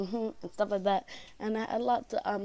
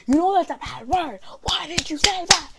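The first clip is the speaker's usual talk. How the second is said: high, loud imitation of a scolding teacher; mock angry scolding; 9 semitones higher, 7 dB louder than usual. Teacher scolding student outside the classroom, mock angry. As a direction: tell the child off, hammed-up.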